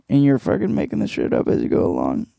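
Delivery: ordinary talk, nothing marked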